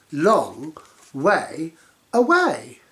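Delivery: very slow, stretched long; drawn out. Acted. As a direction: stretch it to show distance.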